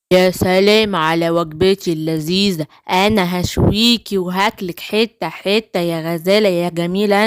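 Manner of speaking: low villain wolf savouring his meal; low, hungry wolf; gloating wolf. Acted.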